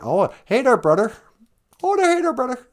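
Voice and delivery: "hey there" with a hard t for th, pitched high; rez accent, no th. Retold or imitated. Greeting a brother in a high rez accent, th as t. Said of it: "I cannot pronounce my T Hs".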